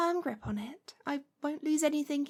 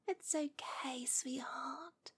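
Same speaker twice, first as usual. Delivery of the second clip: reassuring whisper; 11 dB quieter, much slower than usual. Acted. Soothing whisper.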